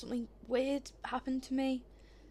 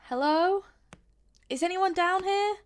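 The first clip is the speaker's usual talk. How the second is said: raised, questioning call for anyone there; 6 semitones higher, 6 dB louder, slower than usual. Acted. Visitor searching downstairs for anyone, calling out. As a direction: call out louder, lifting each question at the end.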